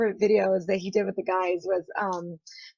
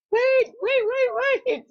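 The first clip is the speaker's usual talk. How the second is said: high, louder rapid-fire plea; calling out urgently; 4 dB louder than usual. Retold or imitated. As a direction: frantically call someone back. Retold calling someone back.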